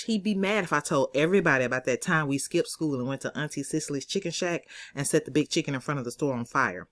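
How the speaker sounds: plain reading voice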